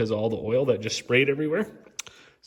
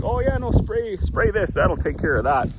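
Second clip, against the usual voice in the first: high, eager salesman voice; chirpy know-it-all pitching a fix; mock salesman; 8 semitones higher than usual. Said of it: "They'll just be like".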